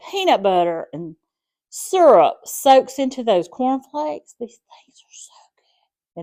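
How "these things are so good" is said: whisper. Real, not acted. Savoring the treat, whispered aside.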